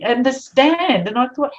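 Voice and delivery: ordinary delivery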